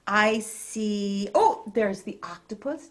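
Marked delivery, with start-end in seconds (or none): none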